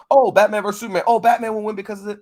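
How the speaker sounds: high, loud mock fan debate; excited fanboys. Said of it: "They're just like".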